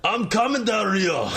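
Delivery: high Scottish call; Drew McIntyre impression. Co-host as Drew rushing to Del Rio, Scottish accent. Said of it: "That was more Scottish".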